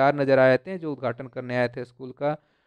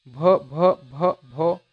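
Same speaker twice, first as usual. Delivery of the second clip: mild stammer on भ, a little louder at the start; 4 dB louder, much slower than usual. Acted. Nervous girl tries to say her name to teacher, mild stammer. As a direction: haltingly repeat भ like a nervous girl. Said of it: "she began to stammer".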